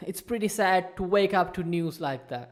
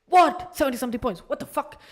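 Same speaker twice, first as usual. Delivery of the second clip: higher, loud mock shock; shocked disbelief; 8 semitones higher, 5 dB louder than usual. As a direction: replay the jolt of surprise. Retelling his shocked reaction.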